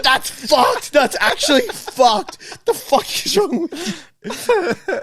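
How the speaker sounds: high, slow, appalled outburst; disgusted outrage. Retold or imitated. Mimicking the mate's disgusted outrage at the prank. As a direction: sound betrayed and grossed out. Friend disgusted after tasting.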